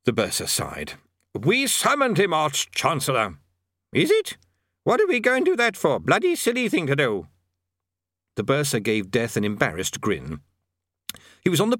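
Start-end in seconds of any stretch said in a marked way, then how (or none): 1.3-3.4 raised voice. A narrator's reading. Bursar to deaf man. 3.9-7.3 put-on old wizard. A narrator's reading.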